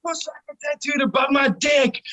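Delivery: high, hyped-up boast; whooping party holler; excited shout. Retold or imitated. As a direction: yell it like a rowdy bandmate. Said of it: "was, like, saying it all the time".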